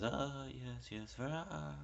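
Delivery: high, nervous "Oh, yes, yes, ah."; imitating a flustered lady. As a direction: play her as a flustered, hesitant shop assistant. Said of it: "And the lady said".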